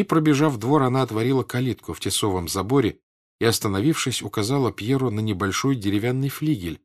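ordinary delivery, nothing marked

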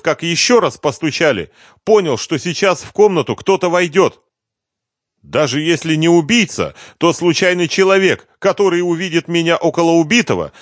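high-pitched, agitated confession; frightened suspect. A narrator's reading. Suspect goes on with account.

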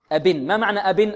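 normal talking voice

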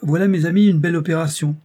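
high, loud and triumphant; the villain's exultant announcement; triumphant cry. A narrator's reading. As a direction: proclaim it to your accomplices in triumph. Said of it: "le dentiste s'écria triomphalement".

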